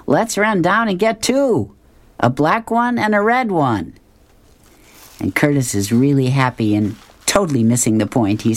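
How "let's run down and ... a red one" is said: higher, playful mock enthusiasm; a dad playing along with a laugh; joking dad. Dad teasing Curtis about the Lamborghini, higher and playful. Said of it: "he's still laughing, and he says".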